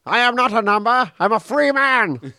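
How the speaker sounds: high, drawn-out defiant declaration; Number Six. Acted. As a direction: proclaim it in protest, pitched well up. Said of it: "Number six".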